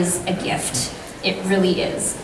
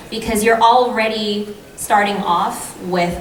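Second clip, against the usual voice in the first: ordinary delivery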